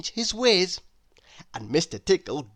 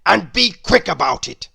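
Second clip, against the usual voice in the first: loud, cross grumble; a bad-tempered old grouch barking back; grumpy Mister Grumpy; 3 semitones higher, 9 dB louder than usual. Grumpy bullies the butcher.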